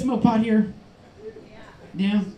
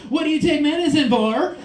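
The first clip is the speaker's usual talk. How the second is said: higher-pitched voice, lifting at the end; retold question pitched up in curiosity; imitated nosy question; 6 semitones higher, 4 dB louder than usual. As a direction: imitate her asking, raised and pointed. Acts her asking the question.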